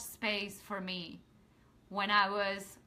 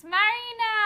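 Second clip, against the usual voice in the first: high, loud delighted call of a name; bright shout of welcome; excited call; 11 semitones higher, 7 dB louder than usual. Real, not acted. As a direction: call her name out with joy. Calling out a friend's name.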